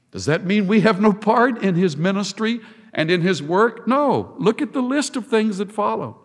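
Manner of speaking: ordinary delivery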